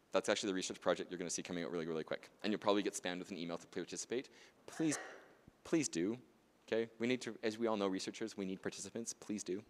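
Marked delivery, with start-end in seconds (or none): none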